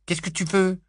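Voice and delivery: high, loud hostile demand; angry. A narrator's reading. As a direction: snap it out at the intruder. Angry boy confronts Petunia. (Rogue agressif face à Pétunia, voix forte)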